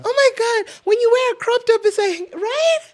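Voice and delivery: very high, squealing caricature; loud, high-pitched girly mimicry; mock male-centered friend. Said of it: "those kinds of friends".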